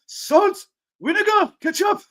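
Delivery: high, loud mock Turkish accent; Turkish kebab worker. Kebab shop's standard question, high loud Turkish accent. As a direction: call each item separately like a Turkish server. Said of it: "this is a certain thing".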